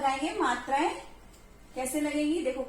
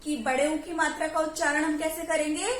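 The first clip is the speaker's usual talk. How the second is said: ordinary talk, nothing marked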